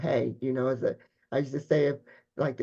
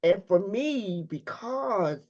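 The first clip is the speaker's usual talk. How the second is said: real tearful, high-pitched voice breaking through laughter; emotional, choking up; 7 semitones higher, 3 dB louder than usual. Real, not acted. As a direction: fight back tears while giving heartfelt thanks. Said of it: "okay, now I'm getting emotional".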